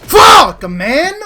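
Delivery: sudden high, loud yell of rage; furious shout. Real, not acted. Streamer losing the set live, furious shout. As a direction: scream at the screen. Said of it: "losing live match".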